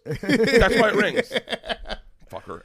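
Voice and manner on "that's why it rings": high, slow, loud exasperated retort; exasperated yell. Retold or imitated. Husband to wife about her ringing phone, yelling. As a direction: drag it out in a loud comic yell. Mock-exasperated answer, escalated.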